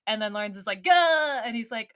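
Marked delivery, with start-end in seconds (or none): 0.8-1.4 sudden high, loud "gah" of fright; startled yelp. Retold or imitated. Lawrence startled when the dragon speaks, yelping. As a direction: jump out of your skin on it.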